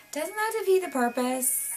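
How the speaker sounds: high, disbelieving question; mock the pointless mask look; incredulous scoff. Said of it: "and I'm like".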